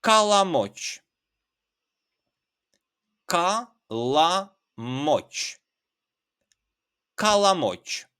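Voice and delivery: kalamoç modelled firmly; word demo. Shown as a demonstration. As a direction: sound out kalamoç for learners. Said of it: "And then in Geg".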